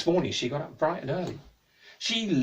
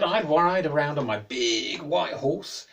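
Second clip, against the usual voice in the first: slow storytelling; 5 dB louder, much slower than usual. Acted. Man recalling happy days.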